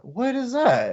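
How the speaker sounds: high startled cry of what is that; jolted shout at a sudden dust cloud. Retold or imitated. Seeing a dust storm by the gate, startled.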